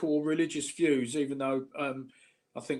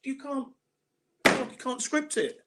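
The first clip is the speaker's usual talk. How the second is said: much higher, rising disbelief; you couldn't make it up; high exasperated repetition, slowing at the end; 10 semitones higher, 3 dB louder than usual. Real, not acted. Exasperated about man popping up.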